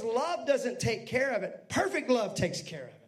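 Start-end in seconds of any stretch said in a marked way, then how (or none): none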